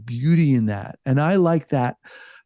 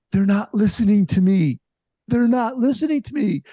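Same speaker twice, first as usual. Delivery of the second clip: retold exasperation, higher and insistent; mock-frustrated protest, building higher; frustrated complaint; 7 semitones higher than usual. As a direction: replay your frustrated venting, pitch climbing on the repeat. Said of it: "I was so frustrated".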